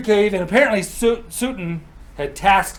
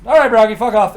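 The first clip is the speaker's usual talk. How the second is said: loud, raised send-off; brash dismissal; 6 semitones higher, 9 dB louder than usual. Acted. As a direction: brush him off loudly. Odin dismisses Braugi once he's in.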